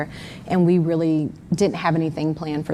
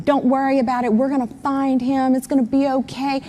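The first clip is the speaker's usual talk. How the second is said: mocking Warren; 7 semitones higher than usual. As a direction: parrot his empty reassurance sarcastically. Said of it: "this is coming from Warren".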